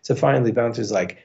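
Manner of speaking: usual speaking voice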